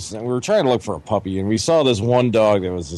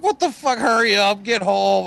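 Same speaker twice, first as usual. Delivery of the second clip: urgent outburst; 8 semitones higher than usual. Retold or imitated. Retold panic in car.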